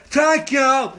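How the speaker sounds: loud, high fake deaf speech; mock deaf voice. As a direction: blurt it with slurred consonants, imitating deafness. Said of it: "But I was like".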